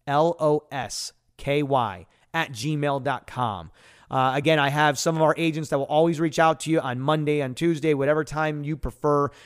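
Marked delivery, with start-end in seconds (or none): 0.1-3.7 letter by letter, slowly; spelling out slowly. Shown as a demonstration. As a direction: spell each letter out slow. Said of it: "I'll spell that out for you".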